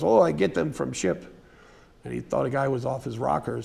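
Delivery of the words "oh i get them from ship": put-on light rough Norwegian accent; hint of a Norwegian rancher. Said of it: "rough Norwegian accent".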